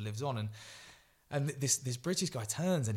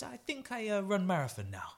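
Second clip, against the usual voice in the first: high, playful musing; light, high imitation of a playful runner; Emil Zatopek; 8 semitones higher than usual. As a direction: float the idea playfully, pitched up. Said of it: "with this playful kind of".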